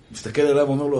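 ordinary talk

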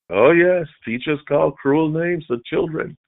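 ordinary talk, nothing marked

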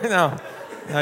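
usual speaking voice

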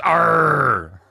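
loud, high pirate "arr"; pirate growl. Pretend. Mock reaction to "Nerds" comment.

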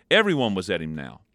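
usual speaking voice

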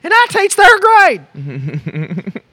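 high, slow sing-song voice; high silly voice. Pretend.

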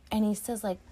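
ordinary delivery

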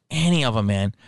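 ordinary talk

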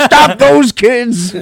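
high, loud, slow villain cry; villain yell. Acted. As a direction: drag out the villain's shout at the kids. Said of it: "he goes".

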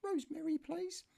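high falsetto shop request; niece asking in shop. Retold or imitated. Niece asking the shopkeeper, high falsetto. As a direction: voice her asking politely, pitched high. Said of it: "you say".

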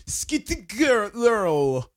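high, very slow German attempt; hammed-up German mispronunciation; mangled German accent. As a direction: struggle through squirrel in a mock German accent. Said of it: "and the German people are like".

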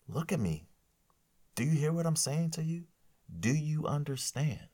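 put-on high, pressing questions to a lying child; a firm parent grilling a fibbing kid; stern parent. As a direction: lean in like a parent demanding eye contact. Parent voiced scolding a kid.

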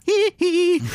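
high squeaky giggle; mock squeaky giggle; little giggle. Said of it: "goes like that".

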